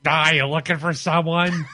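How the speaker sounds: high, put-on old hag voice. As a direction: croak it like a nosy old crone. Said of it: "go into the hag voice".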